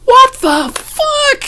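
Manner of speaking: a hammed-up, high-pitched what the fuck; shocked shriek. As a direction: stretch out her startled yell. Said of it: "she's like".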